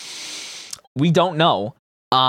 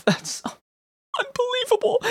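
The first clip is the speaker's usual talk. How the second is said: incredulous; 5 dB quieter than usual. Real, not acted. Laughing disbelief.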